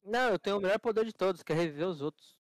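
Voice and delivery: loud. Real, not acted. Excited loud boast.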